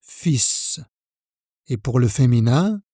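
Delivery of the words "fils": "Fils" said softly as fis, S sounded; quiet final S demo. Shown as a demonstration. Teacher to learner, repeating the word: soft, S kept. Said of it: "on prononce le S final".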